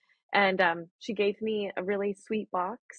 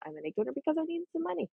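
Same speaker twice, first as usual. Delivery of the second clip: quick, higher, offhand take on a younger Chelsea; imitating past Chelsea; 7 semitones higher, 3 dB quieter, much faster than usual. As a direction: rattle it off like she used to say it. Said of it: "if you would have asked Chelsea before the process".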